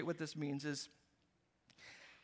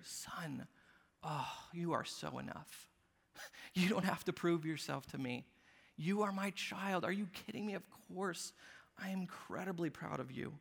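tender father; 5 dB quieter than usual. Retold or imitated. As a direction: tell your boy gently he is enough. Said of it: "I want them to hear".

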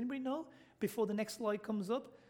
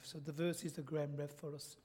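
normal talking voice

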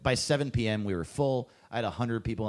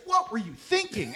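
exasperated scolding; 13 semitones higher, 4 dB louder than usual. Retold or imitated. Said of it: "He's like".

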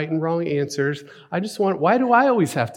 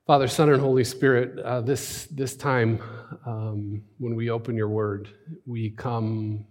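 hushed, low-key prayer with long pauses; 3 semitones lower, 4 dB quieter than usual. Acted. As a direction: pray softly, searching for the words. Leads congregation in prayer.

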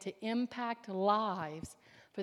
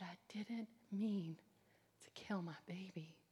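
whispered confession; 3 semitones lower, 10 dB quieter than usual. Retold or imitated.

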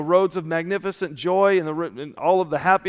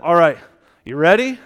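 louder, rising "you ready?"; upbeat check-in; 7 dB louder than usual. Retold or imitated. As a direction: give the groom a brisk, rallying nudge. Preacher briskly checking on nervous groom.

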